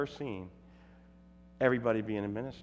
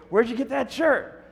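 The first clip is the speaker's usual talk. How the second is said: imitating the heckler; 11 semitones higher, 4 dB louder than usual. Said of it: "then he'd go".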